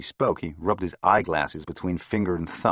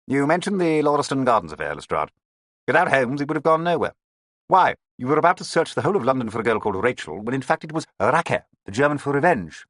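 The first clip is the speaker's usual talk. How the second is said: higher, louder voice of a proud inspector; boastful detective; 9 semitones higher, 5 dB louder than usual. A narrator's reading. Inspector lectures colleagues on the Holmes case, raised and proud. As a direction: crow about the case.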